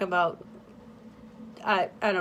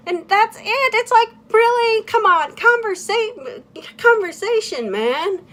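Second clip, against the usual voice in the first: much higher and louder, fed-up disbelief; exasperated outburst; 13 semitones higher, 6 dB louder than usual. Real, not acted. Complaining about the therapist, loud high exasperation. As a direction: vent in exasperation. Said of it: "exasperated outburst".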